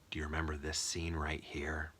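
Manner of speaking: hushed, conspiratorial whispered pitch; whispered reminder. Retold or imitated. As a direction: whisper it like a secret reminder to the viewer. Voices the sales pitch as reminder.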